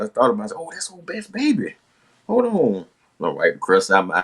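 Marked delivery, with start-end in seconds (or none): 0.6-2.9 sudden eagerness. Retold or imitated. Sudden recognition.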